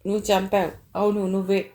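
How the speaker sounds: usual speaking voice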